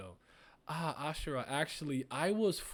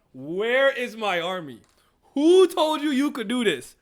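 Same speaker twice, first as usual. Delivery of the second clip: furious commander; 8 semitones higher, 12 dB louder than usual. Acted. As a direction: demand your army back in a rage. Voicing furious character's panel.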